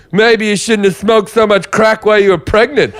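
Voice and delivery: high-pitched Canadian comic voice; high mock-reasonable heckle, played as another man; Tom Stade impression. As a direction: imitate a comic in a high, put-on voice. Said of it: "And then Tom said".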